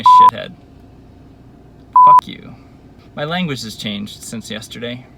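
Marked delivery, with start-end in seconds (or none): 0.0-2.5 high, loud furious curse; real angry shout. Real, not acted. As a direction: release the anger in one blast. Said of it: "'cause I'm angrier".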